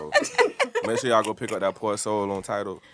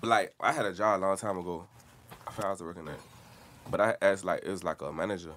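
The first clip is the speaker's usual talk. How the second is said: ordinary delivery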